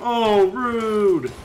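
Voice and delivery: sudden high, loud cry of offense; indignant outburst. Real, not acted. Gamer yelping at an unfair boss, high and loud. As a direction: blurt it out like you've been wronged.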